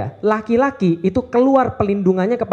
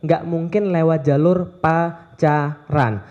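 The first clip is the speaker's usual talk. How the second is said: nggak hit hard, the rest drawn out very slowly; slow stress on nggak; 3 semitones lower, much slower than usual. Real, not acted. Preacher repeats his warning to the crowd, slow stress on nggak. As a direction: lean on nggak and spell out every word. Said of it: "Ingat baik-baik".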